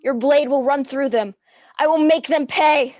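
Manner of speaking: fierce vengeful vow. Acted. Widow gripping the dagger, swearing loud furious revenge. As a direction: swear vengeance with full force.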